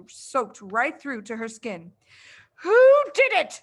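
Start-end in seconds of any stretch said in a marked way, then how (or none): 2.6-3.6 furious headmistress roar, high and loud; a towering, enraged roar. Acted. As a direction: bellow it at the whole class. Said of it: "she roared".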